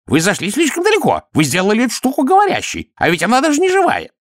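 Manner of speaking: indignant, high-pitched tirade; barked accusation. A narrator's reading. High priest berates Vimes about the talking golem, barking angrily. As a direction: scold him in outraged, high voice. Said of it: "рявкнул чудакули".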